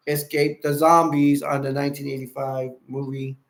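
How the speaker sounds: usual speaking voice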